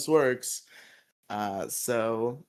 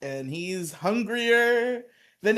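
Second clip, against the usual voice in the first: slow, loud, higher hype; trailer-voice buildup; hyped announcer; 7 semitones higher, 3 dB louder, much slower than usual. Acted. Hyping the villain's return like a trailer, slow.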